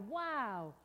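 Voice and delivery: drawn-out wow of amazement; storybook wonder. Acted. Storyteller reacts to boy lifted skyward, wide-eyed wonder. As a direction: marvel at it for young listeners. Storybook exclamation at balloon ride.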